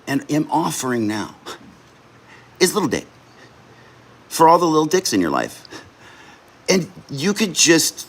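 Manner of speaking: high, keyed-up infomercial pitch; sales pitch. Acted. Streamer pitching his gift idea to viewers, hyped salesman voice. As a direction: sell the little dick gift like a TV ad.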